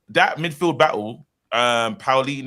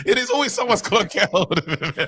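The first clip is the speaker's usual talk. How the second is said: laughing; 7 semitones higher than usual. Real, not acted. Mocking Neville catchphrase, laughing.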